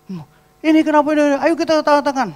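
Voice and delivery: high, loud, fast tirade; sudden high-pitched scolding; mock angry outburst. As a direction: imitate someone suddenly thunderstruck with rage. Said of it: "Tiba-tiba kayak kesambet geledek".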